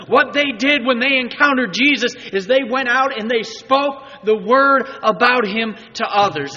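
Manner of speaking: high, loud, emphatic preaching; loud preacher's proclamation, pitched high; preaching at full force. Real, not acted. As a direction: proclaim it to the whole congregation.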